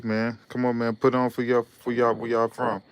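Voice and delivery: usual speaking voice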